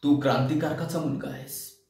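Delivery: low, hushed reminder of duty; people's admonition. Acted. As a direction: quote the public softly and low. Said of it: "लोकांच्या या अपेक्षेला बांधला". (लोकांची हळू, खालच्या पट्टीतली समज)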